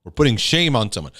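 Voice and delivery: usual speaking voice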